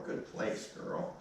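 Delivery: soft unvoiced praise to the dog; hushed good place; whispered praise. Shown as a demonstration. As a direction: breathe the praise quietly. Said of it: "See how I repeat that?".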